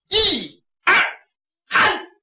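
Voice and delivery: high, punchy command to a crowd; shouted count-in. Acted. Henchman cues the captives to yell, shouted count-in. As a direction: count them in with a sharp shout. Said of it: "我喊一二".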